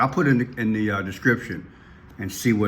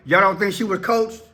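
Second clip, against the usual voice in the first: high-pitched, disbelieving question; incredulous challenge; 8 semitones higher than usual. Real, not acted. Commentator asking viewers if child was coached, incredulous. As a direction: challenge the listeners, pitched well up.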